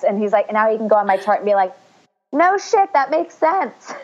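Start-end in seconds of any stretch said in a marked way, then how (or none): 2.3-3.9 very high-pitched pretend revelation; mock realization. Imagined doctor reading her chart, high mock realization. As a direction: shoot the pitch up as it dawns on you.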